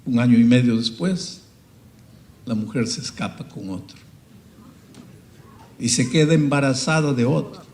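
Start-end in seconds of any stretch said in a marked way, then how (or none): none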